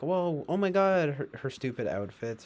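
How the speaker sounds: lightly mocking gushy shock; put-on awe; mock amazement. Mocking people shocked by her outfits.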